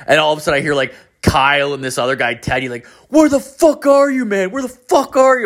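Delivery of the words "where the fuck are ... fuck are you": high, loud yell of angry teammates; mock yell. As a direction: shout it like furious teammates over headset. Friends yelling over game chat.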